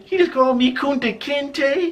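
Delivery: high-pitched, drawn-out disbelief; incredulous. Retold or imitated. Thinking over the stranger's insult, comically high incredulity. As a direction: squeak it out in slow, stunned disbelief.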